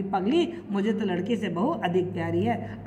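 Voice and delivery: soft, low affectionate voice; fondly. Retold or imitated. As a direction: tease her tenderly. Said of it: "रस कर बोली".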